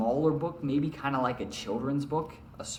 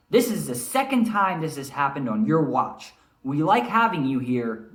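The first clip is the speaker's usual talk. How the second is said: louder, firm scolding; stern manager; 3 semitones higher, 6 dB louder than usual. Acted. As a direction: warn him like a boss losing patience. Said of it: "he's stern, kinda like Mister Grassel, shaking his finger".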